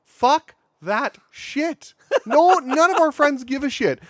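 high, loud exasperated rant; mock rant. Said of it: "I'm like".

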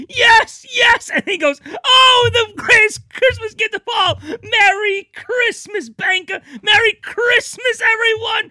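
high, loud, tearful joyous shouting; ecstatic It's a Wonderful Life ending. Retold or imitated. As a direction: scream with overjoyed sobbing glee like George Bailey. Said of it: "like the end of It's a Wonderful Life".

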